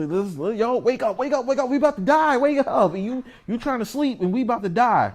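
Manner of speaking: high, loud frantic pleading to wake him; scared sailors shouting over the storm; panicked yelling. Retold or imitated. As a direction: shake him awake, we're sinking. Disciples panicking, waking Yahushua.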